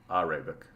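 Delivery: "Arabic" as a low, soft AH-ray-bik; first vowel AH. Shown as a demonstration. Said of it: "not Arabic".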